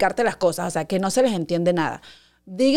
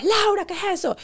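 high alarmed mother; a startled mom finding the wound, pitched up; mom's alarm; 10 semitones higher than usual. Retold or imitated. Mother discovers the scraped knee, high and alarmed. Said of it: "me vio como a las cinco horas".

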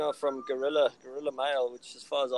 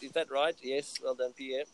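ordinary talk

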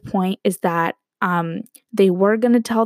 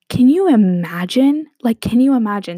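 loud, higher, stretched-out astonishment; amazed disbelief; 3 semitones higher, 5 dB louder than usual. Real, not acted. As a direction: press the listeners to share your amazement. Amazed at the story.

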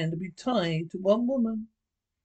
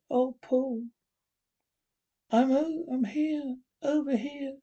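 higher, lightly voiced young woman; young wife Sarah; 6 semitones higher than usual. Wife answering her husband in the dark, lightly voiced woman. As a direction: lift the voice for the frightened wife.

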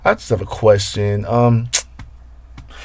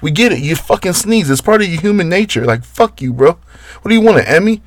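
exasperated rant; 8 semitones higher than usual. Acted. Fed-up rant at the sneezer.

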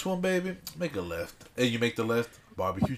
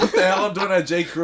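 laughing disbelief; 6 semitones higher, 3 dB louder, faster than usual. Retold or imitated. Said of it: "I'm like".

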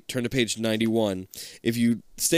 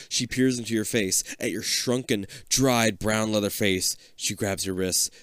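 normal talking voice

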